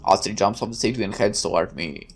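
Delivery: ordinary delivery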